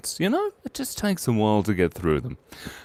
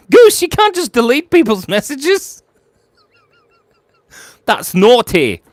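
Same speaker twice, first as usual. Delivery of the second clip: high, loud squeal of mock outrage; laughing scold; 8 dB louder than usual. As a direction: scold while cracking up. Mock-scolds mod while laughing.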